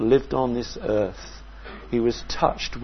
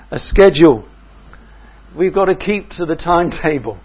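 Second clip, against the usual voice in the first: higher, louder, impatient; busy disciples; 4 semitones higher, 8 dB louder than usual. Retold or imitated. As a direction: insist on the schedule. Disciples could have said.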